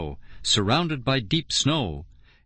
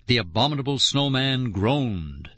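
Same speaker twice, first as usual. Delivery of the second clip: ordinary delivery, nothing marked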